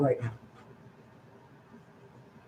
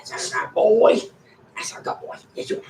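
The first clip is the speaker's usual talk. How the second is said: whispered praise; 2 semitones higher, 4 dB quieter, faster than usual. Real, not acted. Praising the dog, a tender whisper.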